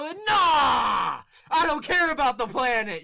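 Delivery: loud high dismissive shout; shouted refusal. Retold or imitated. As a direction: blow him off at full volume. Dismissive refusal in retold short.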